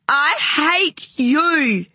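put-on high, loud, slow child's scream of hate; small boy screaming at his mother on the bed. A narrator's reading. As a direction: hurl it at her full force. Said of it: "he screamed".

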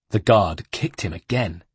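usual speaking voice

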